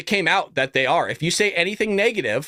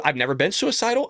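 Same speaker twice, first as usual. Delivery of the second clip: ordinary talk, nothing marked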